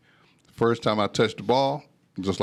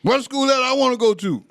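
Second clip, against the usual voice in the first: high, loud defiant outburst; raised voice; 5 dB louder than usual. Retold or imitated. Teen son defying his dad, loud high outburst. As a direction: raise your voice at your father. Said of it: "I kinda raised my voice".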